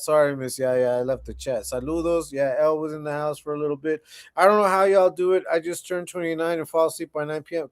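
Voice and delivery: ordinary delivery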